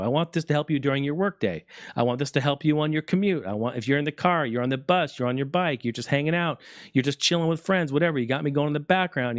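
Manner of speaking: ordinary talk, nothing marked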